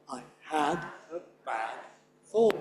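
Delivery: tiny "I" and "a", beats on "had", "bad", "fall"; unstressed I. Shown as a demonstration. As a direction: space "had", "bad", "fall" evenly, tucking "I" away. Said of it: "Not stressed".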